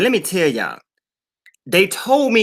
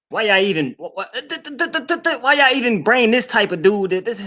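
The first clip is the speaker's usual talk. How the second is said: fake stammer; 6 semitones higher than usual.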